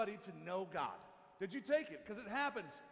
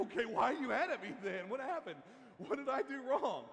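raised, higher-pitched mock pleading; bewildered protest; 4 semitones higher, 5 dB louder than usual. Husband asks wife why she's mad, bewildered. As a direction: act out a baffled husband defending himself.

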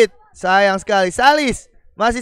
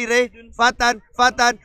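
ordinary talk, nothing marked

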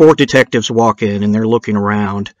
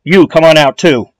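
louder, higher police command; yelled order; 5 semitones higher, 6 dB louder than usual. A narrator's reading. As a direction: call out sharply to the second man. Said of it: "yells to him".

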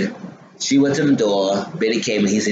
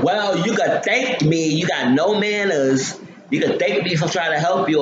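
sassy demand; 7 semitones higher than usual. Retold or imitated. Voicing Veronica demanding thanks.

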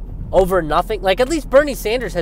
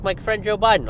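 higher mock of the catchphrase; imitating Bernie; 7 semitones higher than usual. Mimicking Bernie's favorite line about Biden, lightly higher. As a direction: lightly send up Bernie's pet phrase. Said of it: "He's like".

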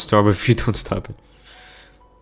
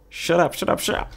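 flustered; 8 semitones higher, 3 dB quieter than usual. Acted. Flustered character silences the laughers.

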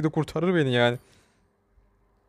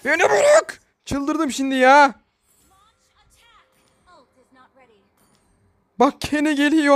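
desperate high yelling; 9 semitones higher than usual. Real, not acted. Gamer begging an enemy to leave him alone, frantic. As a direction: beg to be let go, near hysteria.

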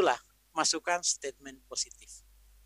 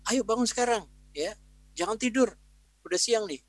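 mildly raised parent voice; urging wake-up call; 6 semitones higher, 3 dB louder than usual. Acted. Parent telling a child to get up now, urging wake-up call. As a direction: start higher, urging the child up.

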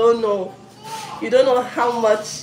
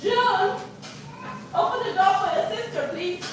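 calling out; 10 semitones higher, 6 dB quieter than usual. Real, not acted. Mother calling to daughter offscreen.

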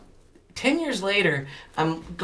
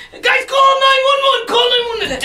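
imitating an urgent shout, much higher and louder; urgent yell; 7 dB louder than usual. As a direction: yell for help like it's a real emergency. People calling for emergency help.

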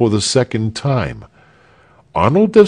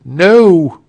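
loud, high, drawn refusal; emphatic no; 9 semitones higher, 7 dB louder than usual. A narrator's reading. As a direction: call out a firm refusal. Answering the disappointed crowd.

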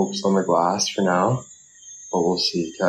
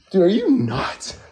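high, slow disbelief; incredulous; 9 semitones higher than usual. Real, not acted. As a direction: ask it like they're crazy. Mock outrage at pileup idea.

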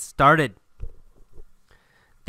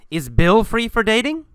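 raised, higher-pitched question; yelling; 7 semitones higher than usual. Acted. Julia asks her ex as she leaves, a mild yell. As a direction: call it out with a rising lilt. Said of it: "she yells".

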